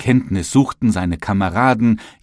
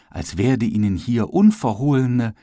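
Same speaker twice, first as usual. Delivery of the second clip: ordinary delivery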